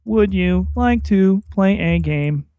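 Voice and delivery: flat computer voice asking to play a game; robot voice. Acted. Cohost plays the clairvoyant computer, robot voice. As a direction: talk like a machine from an old hacker movie. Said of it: "You have, like, the robot voice".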